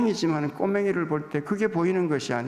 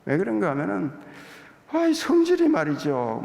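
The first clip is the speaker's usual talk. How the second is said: ordinary talk, nothing marked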